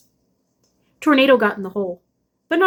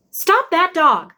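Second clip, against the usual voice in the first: higher, slow mock call from a window; mother calling from the kitchen window, mildly raised; mother crying out; 7 semitones higher, 3 dB louder, much slower than usual. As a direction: call out to stop the dog, drawn out. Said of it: "my mother cried".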